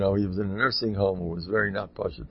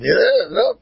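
high, loud yell of enough; retold yell; 12 semitones higher, 10 dB louder than usual. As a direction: shout it like the old guest refusing more food. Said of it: "And he screamed".

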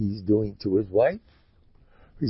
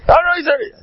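very high, loud mock outburst; screaming; 9 dB louder than usual. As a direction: scream it in staged outrage. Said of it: "I'll scream again".

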